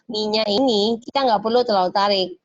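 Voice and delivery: ordinary delivery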